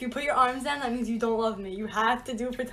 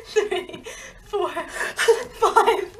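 counting through loud bursts of laughter; squealing with laughter while being tickled; laughing; 7 semitones higher, 7 dB louder, much slower than usual. Real, not acted.

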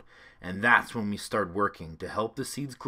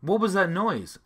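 put-on higher young boy's voice, a little louder; curious kid asking mom; child's question; 7 semitones higher, 5 dB louder than usual. A narrator's reading. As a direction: boyish curious ask. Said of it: "Alex asked".

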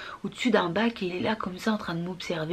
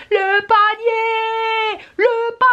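high drawn-out shriek, very loud; a little boy shrieking for the basket; screaming child; 13 semitones higher, 6 dB louder, much slower than usual. Retold or imitated. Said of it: "il se met à hurler".

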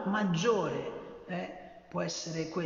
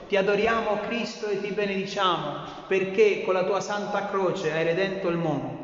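liturgical reading; 3 semitones higher, 7 dB louder than usual. Acted. Reader proclaims Jesus' death on the cross, solemn and full-voiced. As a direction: clear proclaimed Gospel reading, louder. Reads the Gospel station.

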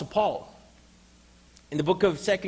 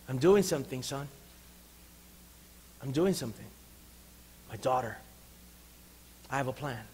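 soft, lower, unhurried appeal; tender reassurance; 3 semitones lower, 7 dB quieter than usual. Acted. God asking a suffering child to trust, tender. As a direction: speak gently with long pauses. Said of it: "God is saying".

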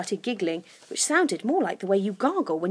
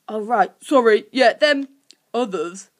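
lightly flustered, louder creature; playing along; 6 dB louder than usual. A narrator's reading. Guff covering his slip, playing along. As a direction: catch on and hastily agree. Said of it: "Guff quickly said, trying to play along".

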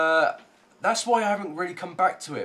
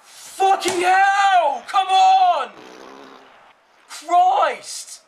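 full-throated rage, much higher and louder, the first word dragged slow; furious shout; 12 semitones higher, 9 dB louder, much slower than usual. Real, not acted. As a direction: scream it at the screen. Raging as opponent scores.

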